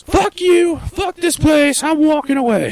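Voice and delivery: high mock shout; drunk woman yelling. Woman kicked out of the bar yelling, high mock shout. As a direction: holler it like a tossed-out drunk. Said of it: "She just goes".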